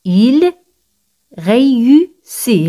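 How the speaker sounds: "Il ... réussit", very slow, in separate syllables; slow repeat-after-me. Shown as a demonstration. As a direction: pause after "Il", then draw out "réussit". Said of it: "Repetid conmigo".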